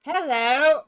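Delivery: loud, high British hello; accidental British-accented call. Real, not acted. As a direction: greet brightly in a British accent. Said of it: "why I have a British accent".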